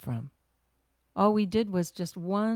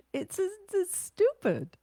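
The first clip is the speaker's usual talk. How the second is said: laughing squeak; 12 semitones higher, much slower than usual. Real, not acted. Laughing at own excuse.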